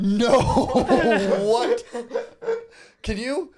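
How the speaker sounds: high, loud shocked laugh and what; stunned, laughing disbelief; blurting out amazed that others can cry on cue; real disbelief. Real, not acted.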